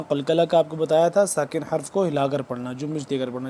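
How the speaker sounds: usual speaking voice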